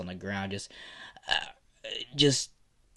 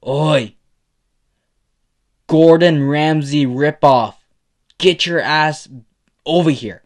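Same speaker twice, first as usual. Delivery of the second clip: loud, higher-pitched taunting yell; 4 semitones higher, 11 dB louder, slower than usual. A narrator's reading. Izuku calling out to find Bakugo, yelling. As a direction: holler it at someone a floor away. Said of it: "he would just yell out".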